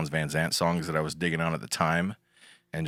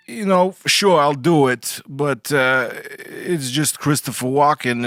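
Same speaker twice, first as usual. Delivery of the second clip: high, loud, halting celebrity impression; Christopher Walken; 7 semitones higher, 7 dB louder than usual. Podcast guest obliges host's request for an impersonation, Walken voice. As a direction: put on an odd, higher voice, slow and deliberate in places. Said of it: "can you do your Christopher Walken impersonation".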